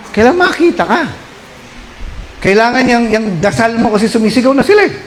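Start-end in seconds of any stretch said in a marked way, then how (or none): 0.1-1.0 emphatic high. Acted. Preacher stresses a point.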